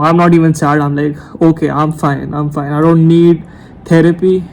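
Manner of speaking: ordinary delivery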